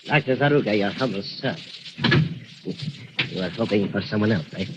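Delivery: soft, menacing villain voice; sinister purr. Acted. Doctor Zaruga softly taunts the captive Miss Lane. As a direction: gloat quietly over the captive.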